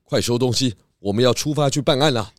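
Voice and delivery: put-on bright, lively kids' show voices; children's drama. Detectives gearing up for a case, lively kids' show read. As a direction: perform it big for young listeners.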